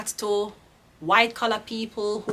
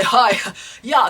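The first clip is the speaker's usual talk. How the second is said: mock greeting; 7 dB louder than usual. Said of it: "you have to say".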